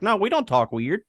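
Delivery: high, sing-song Wisconsin denial; mock Wisconsin accent. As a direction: mimic a Wisconsin local protesting. Imitating Wisconsin locals denying.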